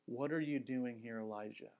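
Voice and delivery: low, soft and hushed question; soft whisper. Acted. God quietly asking Elijah in a low whisper. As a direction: hush it like a near whisper. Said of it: "Why does God speak in a whisper?".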